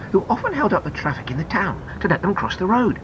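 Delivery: ordinary delivery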